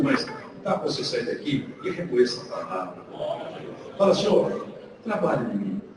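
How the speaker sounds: low, soft prayer voice; quiet closing prayer. Acted. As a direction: pray gently, winding down.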